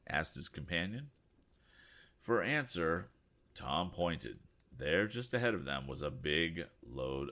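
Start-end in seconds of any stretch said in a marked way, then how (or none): none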